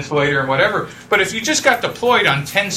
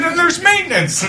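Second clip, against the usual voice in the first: laughing; 11 semitones higher than usual. Retold or imitated. Retelling, laughing.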